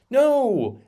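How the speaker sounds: a high, loud mock "No"; high, loud imitating No; mock Nancy Pelosi. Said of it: "I can't do her voice".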